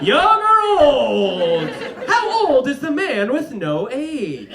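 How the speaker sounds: loud, high, slow showman's spiel; a barker pitching the man with no age, loud and slow; carnival barker pitch. Retold or imitated. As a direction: sell the mystery to the crowd. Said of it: "make that into a carnival game".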